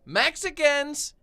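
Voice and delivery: high, loud, drawn-out retold call; mock outburst. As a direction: imitate her calling out incredulously, high and loud. Woman's outburst acted in retelling.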